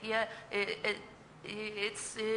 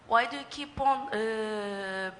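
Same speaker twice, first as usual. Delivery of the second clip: ordinary talk, nothing marked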